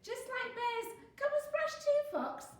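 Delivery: very high, loud playful call; gleeful shouting. A narrator's reading. Friends splashing in river to Fox, high shout. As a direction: shout an invitation to join in. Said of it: "they shouted".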